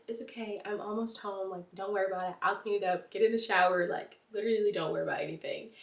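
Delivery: soft, calm comfort; reassuring. Retold or imitated. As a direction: soothe her gently. Boyfriend comforting her.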